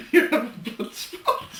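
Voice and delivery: giggling through the words; laughing mockery of the mix-up; laughing tease. Real, not acted. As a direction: tease the slip while laughing. Teasing the slip, laughing.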